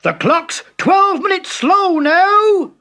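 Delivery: loud, high, slow angry roar; father roaring at Timothy. A narrator's reading. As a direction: roar at the boy like a furious dad. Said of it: "roared his father".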